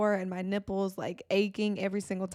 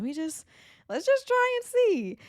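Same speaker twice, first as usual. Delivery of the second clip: put-on sing-song, much higher, coaxing the baby; high baby-talk coaxing; 13 semitones higher, 3 dB louder than usual. Mom coaxing her fussy baby to latch: high sing-song baby talk. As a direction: coo it up high like coaxing an infant to try.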